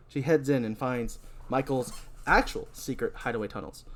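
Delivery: ordinary delivery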